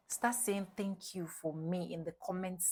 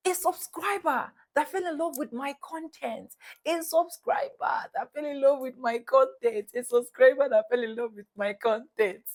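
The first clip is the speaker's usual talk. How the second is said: gleeful; 6 semitones higher, 7 dB louder than usual. Retold or imitated. Repeated gleeful explanation.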